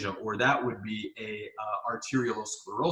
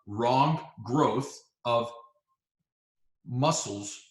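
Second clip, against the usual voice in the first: slow explaining; much slower than usual. Shown as a demonstration. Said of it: "this is what it sounds like".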